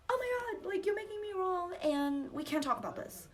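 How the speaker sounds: high, whiny-protest overreaction; exasperated mock outburst. Girlfriend overreacting to boyfriend's reminder, high exasperated outburst. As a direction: act out an upset retort, pitched high.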